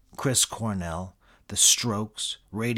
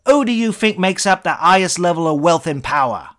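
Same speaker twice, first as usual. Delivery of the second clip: put-on British accent, much higher and louder, shouting; shouting Eric Idle; 10 semitones higher, 12 dB louder than usual. British accent, the celebrity cutting off the singer with a shout. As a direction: bellow the greedy advice in a British voice. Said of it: "your British accent".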